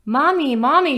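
high, loud call for Mommy; child yelling. Acted. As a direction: cry out for Mommy in a panic. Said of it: "she yelled".

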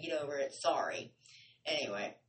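usual speaking voice

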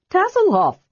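very high, piping cry; excited announcement. A narrator's reading. Friend announces himself from the trees, high excited cry. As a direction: sing out the name high.

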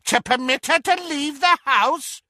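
very high, derisive aunt interruption; cackling mockery. A narrator's reading. Auntie Muriel cutting Doge off, cackling. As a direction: pounce on it with cackling scorn. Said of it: "cackled Muriel".

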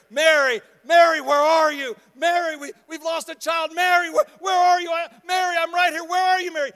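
high, shouted calls of a panicked mother, acted out; frantic yelling. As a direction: yell for your lost child, out of control. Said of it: "out of control, frantically looking for Mary".

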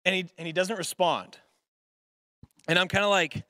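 ordinary talk, nothing marked

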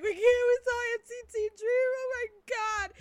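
high-pitched imitation of tearful disbelief; mock crying. Retelling the night they saw NCT Dream, mock crying. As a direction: wail it in an overwhelmed retelling.

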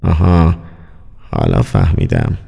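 very low, private murmur; low muttered realisation in character; murmuring to oneself. A narrator's reading. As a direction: drop deep, as if working it out alone. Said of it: "با خود زمزمه کرد".

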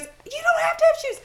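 high, laughing exclaim; laughing urgency. Retold or imitated. Brother dismissing sister's missing shoes, high laughing exclaim. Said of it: "And he goes".

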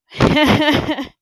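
sudden loud cackle of real laughter; loud laugh burst. Real, not acted. Laughing loudly at a viewer's comment. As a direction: crack up out loud.

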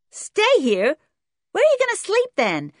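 put-on loud, high-pitched brash kid; demanding American boy. A narrator's reading. Boy questioning the visiting cousins, demanding and loud. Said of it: "demanded the boy".

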